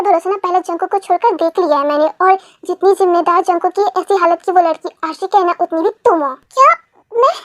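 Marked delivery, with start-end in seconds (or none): 6.3-7.3 shocked. Acted. Accused wife shocked by mother-in-law.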